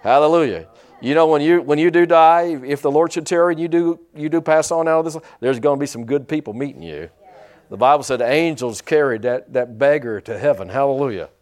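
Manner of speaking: loud, fervent preacher's cadence; preaching. Acted. Preacher assuring the congregation about heaven, fervent preaching. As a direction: lean into the pulpit and proclaim it. Preaching, louder, rising fervor.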